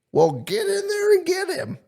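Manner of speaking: put-on high, bossy old lady voice. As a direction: boss him around in a high old lady voice. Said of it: "And she goes".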